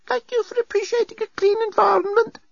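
very high, squeaky little robot; squeaky dustbot. A narrator's reading. Dustbot to passenger after picking up dust, squeaky. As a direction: squeak it out in a tiny piping voice.

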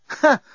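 short, high, loud "ha"; derisive snort. A narrator's reading. Nettie scorning the unhelpful desk bot, derisive snort. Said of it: "snorted Nettie".

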